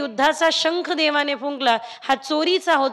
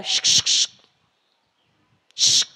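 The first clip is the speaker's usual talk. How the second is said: hissing psst call. Acted. Gopi secretly calls out to husband.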